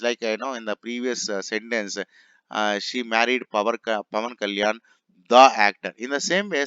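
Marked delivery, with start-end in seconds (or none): 5.3-5.9 "the" hit hard and much louder; stress on THE. Shown as a demonstration. Teacher showing emphasis before a noun, stressed "the". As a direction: punch "the" as a full, strong word. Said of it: "I want to emphasize".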